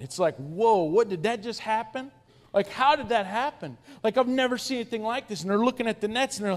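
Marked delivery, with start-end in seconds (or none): 0.3-5.4 amazed disbelief, pitched up; wow moment. Retold or imitated. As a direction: act out jaw-dropped astonishment. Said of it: "It's a wow moment".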